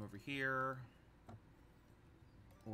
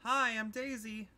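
high character voice; 10 semitones higher, 3 dB louder than usual. Acted. Voicing a game character.

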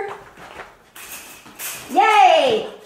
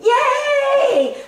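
a high, loud cheering shout; excited praise; 10 semitones higher, 4 dB louder than usual. Real, not acted. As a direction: celebrate the puppy's choice. Praising the puppy for choosing bed.

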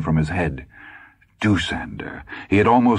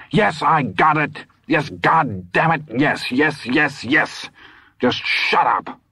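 scream; 9 semitones higher, slower than usual. A narrator's reading. Todd screams at Dusander.

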